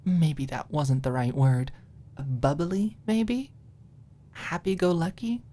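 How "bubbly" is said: usual speaking voice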